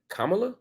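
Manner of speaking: Kamala with the accent up front, KAH-muh-la; correctly pronounced Kamala. Shown as a demonstration. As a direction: stress the first syllable of Kamala. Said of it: "put an accent on the first syllable".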